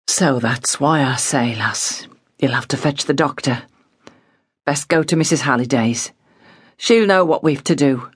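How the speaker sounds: a hint of a weary father; hopeless father. A narrator's reading. Father's voice held.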